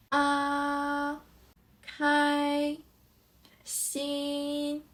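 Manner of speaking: "Ah, kai, xin" each high and level; first tone. Shown as a demonstration. Teacher gives first-tone examples, "Ah, kai, xin" high and flat. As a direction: hold "Ah", "kai", "xin" flat, no rise or turn. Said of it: "No raised and no turn".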